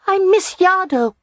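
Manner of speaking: put-on high-pitched child's whine; small boy voice, complaining; complaining little boy. A narrator's reading. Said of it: "Rye complained".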